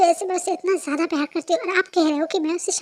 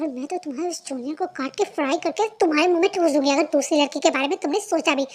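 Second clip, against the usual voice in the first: ordinary talk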